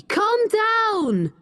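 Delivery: high-pitched urging to calm down; agitated plea. A narrator's reading. Richard to shouting Becky, pleading high. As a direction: urge her in a high, worked-up voice.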